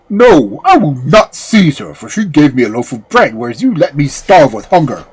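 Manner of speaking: loud, defiant dog voice; talking dog. A narrator's reading. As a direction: refuse loudly as a wronged dog. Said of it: "But the dog answered".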